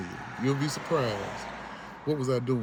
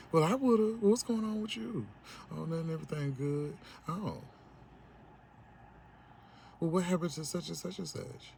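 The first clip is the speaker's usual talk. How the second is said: mock caller; 6 semitones higher than usual. Mocking a caller's fake concern.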